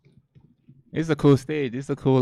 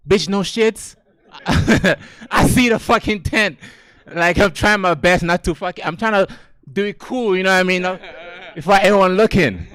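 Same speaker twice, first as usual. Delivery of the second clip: exasperated retort; 5 semitones higher than usual. Retold or imitated.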